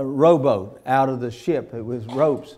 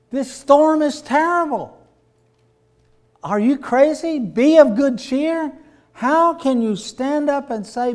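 much higher, rising disbelief; incredulous retort; 10 semitones higher than usual. Acted. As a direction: scoff at the idea in disbelief. Crew mocking Paul's advice.

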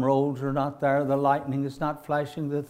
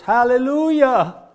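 hollered hallelujah; 10 semitones higher, 12 dB louder than usual. Retold or imitated. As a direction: shout it out across the crowd. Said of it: "and he hollers".

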